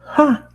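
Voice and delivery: one sharp high "ha"; short high laugh. Acted. Twilight laughing at the only two dimensions idea. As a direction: bark out a single high laugh. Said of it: "Twilight laughs".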